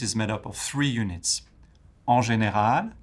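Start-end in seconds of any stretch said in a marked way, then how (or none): 2.1-2.9 GÉNÉRAL climbing on its last syllable; rising intonation. Shown as a demonstration. Teacher showing a first French unit, GÉNÉRAL rising. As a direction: lift the end of GÉNÉRAL. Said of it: "intonation goes up at the end".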